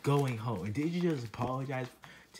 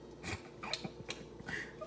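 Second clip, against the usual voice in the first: quiet snicker; 11 dB quieter than usual. Acted. Snickers at Junior's trouble.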